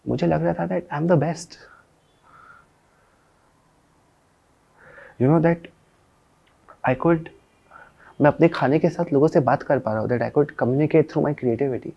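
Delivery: high, energized pride; elated confidence. Acted. Cook telling how he felt he was the best, elated. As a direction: let the pitch soar with newfound confidence.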